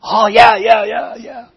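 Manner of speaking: loud, eager chorus of yeses. Retold or imitated. Listeners loudly and eagerly wanting to change their answers. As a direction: burst out agreeing.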